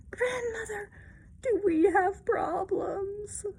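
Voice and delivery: slow, teary kitten voice rising high on Grandmother; crying kittens. Acted. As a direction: sob it out like a relieved little kitten.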